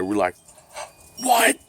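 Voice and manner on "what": high, sudden mock yelp; euphoric disbelief. Teens just let go by police, high euphoric disbelief. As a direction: blurt it like you can't believe your luck. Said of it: "the feeling of euphoria".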